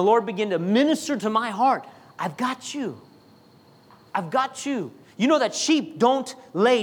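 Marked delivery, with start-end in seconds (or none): 2.2-4.9 soft, quiet reassurance; comforting voice. Acted. The Lord comforting an anxious young pastor, soft and reassuring. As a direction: hold him gently with the words.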